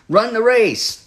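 high, rousing slogan delivery; motivational slogan. Retold or imitated. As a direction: call it out like a pep-talk motto. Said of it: "These are great slogans".

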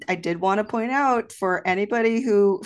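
ordinary delivery

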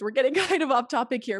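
amused self-correction; laughing aside. Real, not acted. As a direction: laugh it off while speeding up. Laughs at her own tangent.

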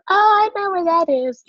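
high, bright oh of sudden recognition; imitating relieved strangers; mock recognition. Strangers finally placing her hometown, mock surprised. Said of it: "They'll go".

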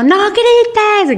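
put-on mother yelling no grites at her child, shrieking; mock screaming mom. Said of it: "gritando como loca".

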